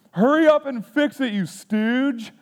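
mock a four-year-old snapping at dad; bratty kid blurting. Son blurting at dad in waiting room, high impatient child voice. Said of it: "blurts out in front of the entire waiting room".

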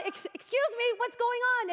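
high, polite call to the ceiling. Retold or imitated. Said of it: "we call up".

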